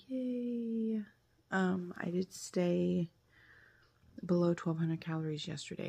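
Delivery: hushed, half-whispered morning chat; keeping quiet. Real, not acted. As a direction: talk softly so nobody wakes up. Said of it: "I'm being quiet so that Mabel doesn't wake up".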